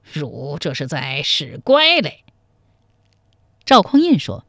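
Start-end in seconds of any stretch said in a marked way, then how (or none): none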